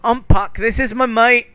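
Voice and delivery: high, loud cheeky fairy; a mischievous fairy talking his way out; high, loud playful trickster; Puck's voice. Acted. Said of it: "He goes".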